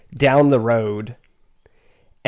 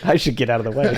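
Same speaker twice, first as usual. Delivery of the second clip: laughing quote; much faster than usual. Retold or imitated.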